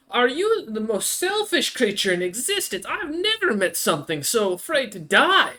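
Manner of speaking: high, appalled monster voice rising in disbelief; horrified creature. Acted. Creature horrified by the plan to wipe everyone out. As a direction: recoil in horror at their selfishness. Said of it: "and then looks at you and goes".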